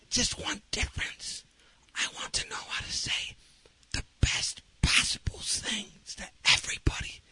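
slow, hushed, spaced-out words; whispering. Retold or imitated. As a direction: whisper the wish with care. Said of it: "I started whispering".

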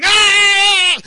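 imitated full-voice scream; loud drawn-out holler; long high yell. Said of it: "And he was yelling".

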